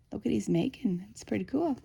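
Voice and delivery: whispered aside over the book. Real, not acted. Reader whispering to a child beside her. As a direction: hush it to the little one. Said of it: "aside to child while reading".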